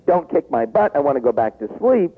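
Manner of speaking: ordinary delivery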